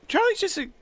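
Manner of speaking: kobold voice. Acted. Slips into Charlie voice.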